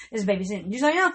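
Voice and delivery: ordinary talk, nothing marked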